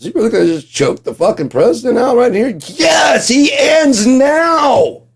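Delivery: hammed-up shouted courtroom frenzy; mock shout. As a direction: build to a roaring climax. Imagined courtroom attack, shouting.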